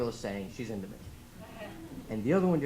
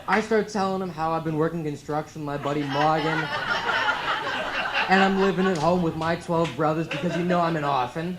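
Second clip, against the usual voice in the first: louder, put-on Boston accent; thick Boston accent, projected; Will from Good Will Hunting; 5 dB louder than usual. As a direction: play a working-class Boston guy. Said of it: "I become Will from Good Will Hunting".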